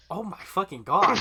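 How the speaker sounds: loud, high-pitched astonishment; retold amazed reaction. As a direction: blurt it out, replaying your amazement. Retelling own amazed reaction.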